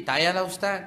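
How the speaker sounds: a hint of a worried old grandmother pleading. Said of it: "dia kata".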